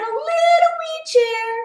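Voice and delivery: high, small voice for the wee bear; little bear's high voice; tiny baby bear voice. A narrator's reading. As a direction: squeak it out like a tiny bear cub.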